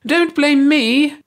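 very high, slow, drawn-out squeak. A narrator's reading. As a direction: squeal it out like a cornered creature. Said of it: "the one called Hersey squeaked".

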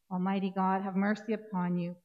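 ordinary delivery

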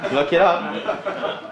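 slow, drawn-out through a laugh. Acted. Host repeating the joke to the audience, laughing. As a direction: crack up mid-repeat.

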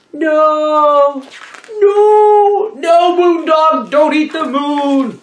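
high, loud cries of no at the dog; frantic shout. Acted. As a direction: yell at the dog to stop. Papagayo yells at the moon dog.

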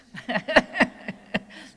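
a short, airy real laugh at herself; breathless laugh. Real, not acted. Laughing at her own salty words to the audience, a breathy giggle. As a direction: let out an unvoiced laugh that rises.

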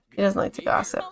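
whispered behind the hand; hushed gossip aside. Real, not acted. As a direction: let it out as a secret aside. Aside about Tabitha.

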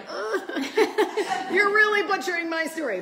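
laughing mock complaint. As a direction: tease him in his voice, laughing. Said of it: "he'll be like".

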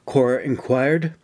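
ordinary delivery

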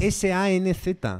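deliberate, separated letters; spelling out. Shown as a demonstration. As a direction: spell it out slowly to correct someone. Spelling the surname to correct.

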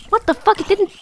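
loud, high disbelief; stunned outburst at the game; shocked. Real, not acted. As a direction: blurt it in shock.

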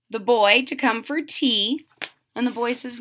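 ordinary delivery

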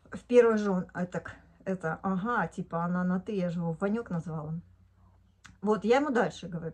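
usual speaking voice

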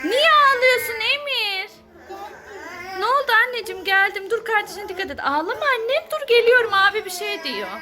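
loud play-acted mother voice; acting the worried mom in play; pretend mother. Role play, mother soothing crying kids. (oyunda anne sesi)

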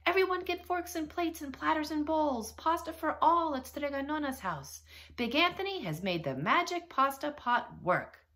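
high, loud town-square proclamation; shouted announcement. A narrator's reading. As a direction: call out to the whole town. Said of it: "and shouted".

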